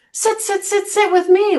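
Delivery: put-on high, friendly old-man invite. Old man inviting the drunk to sit, friendly and high. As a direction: welcome him like an old pal, pitched high. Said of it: "like it's his old friend".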